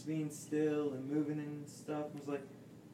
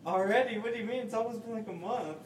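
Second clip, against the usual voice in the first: indignant; 8 semitones higher, 4 dB louder, faster than usual. Real, not acted. Indignant over fish's age.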